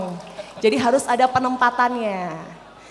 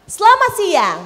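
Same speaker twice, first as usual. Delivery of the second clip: "Selamat siang" high and loud, arching down; varied intonation; 9 semitones higher, 7 dB louder than usual. Shown as a demonstration. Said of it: "lekukan-lekukan sendiri". ("Selamat siang" tinggi, lantang, lalu turun)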